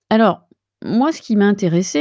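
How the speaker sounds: usual speaking voice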